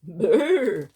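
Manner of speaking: loud, acted shiver; shivering brr. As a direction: let out a big storybook brr. Badger tromps in from cold.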